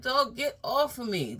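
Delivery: high, loud mock yell pushing him away; high startled holler; yelling to get off. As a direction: shout it like you're pinned to a wall. Being grabbed and hugged.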